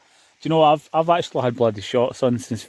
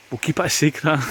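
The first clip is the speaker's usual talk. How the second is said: ordinary talk, nothing marked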